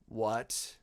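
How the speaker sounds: low, buzzing insect voice. Acted. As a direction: buzz it out like a giant insect. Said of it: "in this insect buzzing voice".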